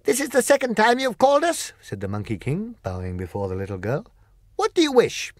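a magical servant asking his task; odd high monkey king voice. Acted.

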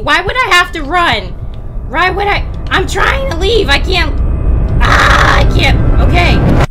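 loud, high nervous yelling with a laugh; frantic terrified pleading; panicked scream-laugh. Real, not acted. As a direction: laugh and scream in real fright.

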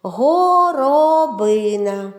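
Горобина slowly, both О sounds held long; виділений звук О. Shown as a demonstration. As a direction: stretch Горобина syllable by syllable. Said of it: "в цьому слові два". («Горобина» повільно, з протяжними «о»)

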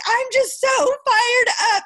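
high, loud, drawn-out mock excitement; cute, fired-up lady. Lady messaging the speaker after the Zoom, fired up and high-pitched. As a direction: gush it slowly, imitating her at high pitch. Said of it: "It was really cute".

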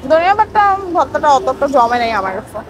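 speaking between bites; talking while chewing. Real, not acted. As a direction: mouth partly full. Seen 1: Took a bite at -2s; lips move as if chewing while talking.

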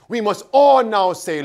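ordinary delivery

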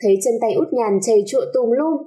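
ordinary delivery, nothing marked

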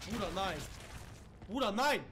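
loud, desperate no; panicked shout. Real, not acted. Gamer to viewers, loud panicked protest. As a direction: shout in real alarm. Monster appears again.

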